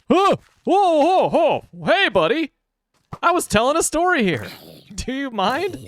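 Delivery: high loud startled whoa; startled yelling. Real, not acted. Startled by a creature on the trail, shouting high and loud to scare it off. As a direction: shoo off whatever jumped out at you.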